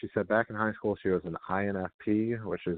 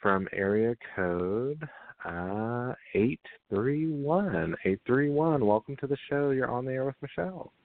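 slow, higher, drawn-out on-air caller introduction; radio host announcing; 5 semitones higher, slower than usual. Acted.

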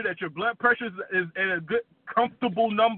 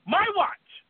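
"My" jumps much higher; "My watch." with "My" pitched high and falling off; rising repeat; 9 semitones higher than usual. Acted. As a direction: push "My" way up high, then fall. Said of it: "repeated higher".

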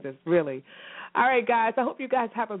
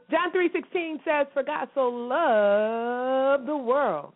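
put-on higher, slow sing-song verse for a child; kid-teaching recitation; 5 semitones higher, much slower than usual. Shown as a demonstration. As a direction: draw out "For God so loved the world" slowly like teaching a third grader. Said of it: "That's how I say it with my third grader".

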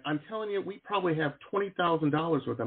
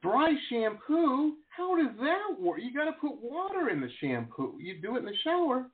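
comically exasperated confusion, stretched at the start; incredulous; 8 semitones higher than usual. Retold or imitated. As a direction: protest like a baffled husband, pitch way up. Retold argument about dry shampoo.

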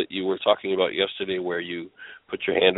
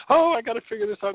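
frantic mock whine; 14 semitones higher, faster than usual. Mocking frantic overthinking.

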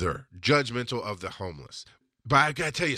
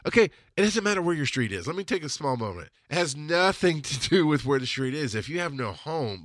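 usual speaking voice

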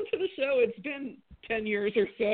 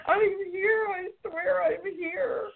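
mock desperate pleading; 10 semitones higher, slower than usual. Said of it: "It's like".

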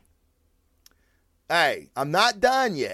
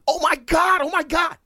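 high-pitched replay of a thrilled scream, much higher; retold excited screaming; 13 semitones higher than usual. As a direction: squeal it like a fan losing it over a scene. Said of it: "I was screaming out loud".

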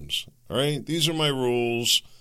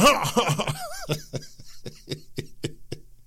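high laugh. Real, not acted. Laughing after own impression.

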